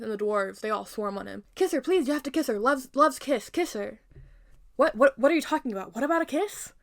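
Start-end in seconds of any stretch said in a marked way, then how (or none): 1.5-4.0 higher, louder, urgent pleading; raised-pitch clamour of desperate pleas; frantic begging. Acted. Dwarves swarm Jonathan, begging. 4.8-6.7 higher, louder, flustered questioning; raised-pitch stammering confusion; baffled. Acted. Baffled Jonathan answers the dwarves.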